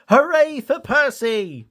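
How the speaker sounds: high, slow joyful cheer; Thomas cheering. A narrator's reading. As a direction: cheer for the rescuer. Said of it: "puffed Thomas".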